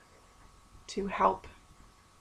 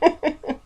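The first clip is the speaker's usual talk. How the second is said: nervous chuckle; 9 semitones higher, 5 dB louder than usual. Real, not acted. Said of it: "a tick again".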